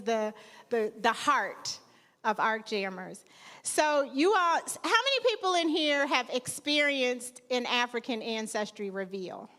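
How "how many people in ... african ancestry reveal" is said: ordinary talk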